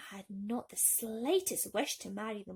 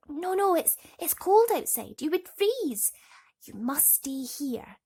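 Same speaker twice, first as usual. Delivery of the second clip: high, urgent little voice; anxious Thumbelina; 7 semitones higher, 11 dB louder than usual. A narrator's reading. Thumbelina urges the swallow to stay inside, high and anxious. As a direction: stop the bird from leaving.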